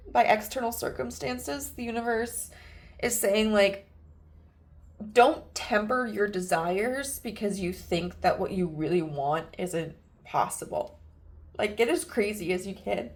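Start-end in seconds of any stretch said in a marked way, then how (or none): none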